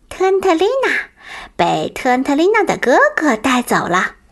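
high thin little voice; thin reedy voice. Acted. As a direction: squeak it out like a wolf cub. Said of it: "细声细气地说道".

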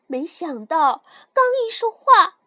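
plain reading voice

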